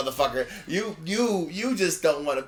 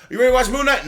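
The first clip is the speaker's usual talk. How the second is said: raised, loud mock-eager asking; eager question; 8 semitones higher, 8 dB louder, faster than usual. Podcaster retelling how he asked his friend to watch, eager question. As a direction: act out keenly checking in.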